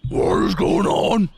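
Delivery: high, loud snorty pig voice. Acted. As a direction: talk like a startled pig. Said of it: "This is my pig voice".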